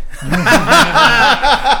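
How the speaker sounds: high, loud burst of emotional laughter; a choked-up laugh that spills out; overwhelmed laugh. Real, not acted. Guest laughing, moved by the host's praise.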